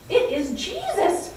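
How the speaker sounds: as if you can't believe who's at the table; excited disbelief. Acted. Disciples realizing Jesus sits with them, excited and high.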